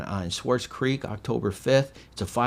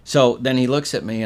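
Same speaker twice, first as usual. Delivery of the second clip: normal talking voice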